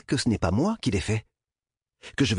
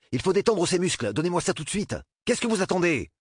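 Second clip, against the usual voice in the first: high, pressing emergency voice; urgent orders; 10 semitones higher than usual. A narrator's reading. Doctor orders nurse to inject the convulsing boy, urgently. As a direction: demand action right now.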